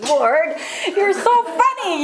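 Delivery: high, giggling delight; tickled, laughing as she speaks; laughing at God. Real, not acted. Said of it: "And I'm like".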